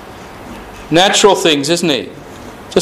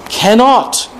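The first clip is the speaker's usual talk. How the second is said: Cannot alone, high and punched; stress on Cannot; 8 semitones higher than usual. Shown as a demonstration. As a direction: hammer the single word Cannot. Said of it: "repeated emphasis on the word cannot".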